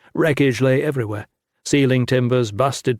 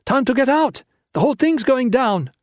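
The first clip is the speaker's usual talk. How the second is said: urgent shout; 12 semitones higher than usual. A narrator's reading. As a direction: shout the warning across the room. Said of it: "Lin shouted from the door".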